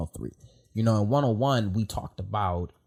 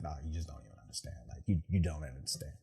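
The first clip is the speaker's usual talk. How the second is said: low, much softer, brushing the question off; low muttered retort; 5 semitones lower, 10 dB quieter than usual. Retold or imitated. To a buddy in the smoke pit, a low muttered brush-off. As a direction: mutter it like nobody gets it. Retelling his reply to the guys.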